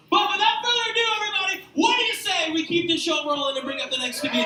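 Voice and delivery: loud, high announcer voice; MC hype. Acted. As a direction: pump the crowd like a club host. Host hypes crowd.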